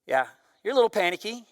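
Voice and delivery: usual speaking voice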